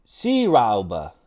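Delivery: clumsy attempt at German Seeraube; rough mock-German accent demo; botched German pronunciation. Shown as a demonstration. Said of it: "Definitely fucking that up".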